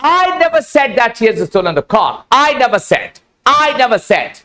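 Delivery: "I" stretched and punched, then the rest runs on; stress on I. Shown as a demonstration. Teacher showing students how intonation changes meaning, stress on "I". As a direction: lean hard on the opening "I". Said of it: "पहले मैं यहां पे जोर देता हूं".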